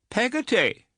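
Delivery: Peggotty said high and scandalised, falling; indignant repeat. A narrator's reading. Aunt echoing the servant's name, high and indignant. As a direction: echo the name in disbelief. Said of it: "repeated Miss Betsy with some indignation".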